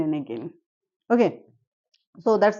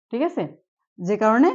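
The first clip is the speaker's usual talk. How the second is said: normal talking voice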